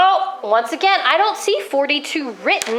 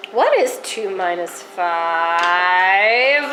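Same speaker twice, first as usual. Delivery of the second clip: slow teacher question; 7 semitones lower, much slower than usual. Shown as a demonstration. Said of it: "teacher poses trick question".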